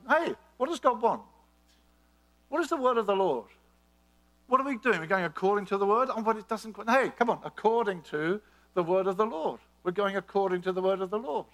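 full-force sermon climax; fervent preaching. Acted. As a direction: preach it with rising fire.

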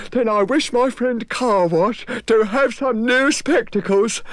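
high, earnest creature voice; a kind beast handing out wishes; eager Moog. Acted. The Moog wishing for his friends, high and eager.

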